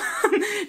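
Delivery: quick high giggle. Real, not acted. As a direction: giggle at your own joke. Laughing at Theo bulldozer image.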